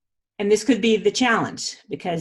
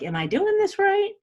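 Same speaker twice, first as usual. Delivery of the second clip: much higher, questioning, half-playful; anxious self-doubt; 12 semitones higher than usual. Retold or imitated. She mimics her own doubt while reading the book, higher and unsure. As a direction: squeak out the worry in a raised voice.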